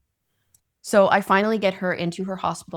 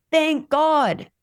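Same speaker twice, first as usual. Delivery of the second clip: higher, louder thank God; relieved exclaim; 9 semitones higher, 6 dB louder than usual. Shown as a demonstration. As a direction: lift "God" up high in relief. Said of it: "And thank God".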